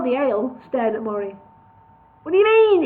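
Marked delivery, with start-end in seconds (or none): 2.2-2.8 loud, much higher demand; forceful. A narrator's reading. Boss demanding an answer from Murray, loud. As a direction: hit him with force. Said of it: "he said with force".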